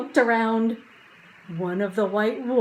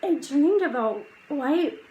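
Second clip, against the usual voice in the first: drowsy muttering; 5 semitones higher, slower than usual. Acted. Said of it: "Annie muttered".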